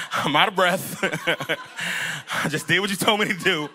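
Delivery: mock protest broken by laughter; laughing protest. Pastor playing a winded disciple before Jesus, laughing protest. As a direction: complain through breathy chuckles.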